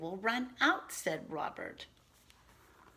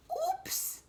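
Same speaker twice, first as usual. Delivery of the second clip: very high squeaky oops; tiny girl's squeak. Acted. Girl caught switching off the lights, squeaky oops. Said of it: "she said".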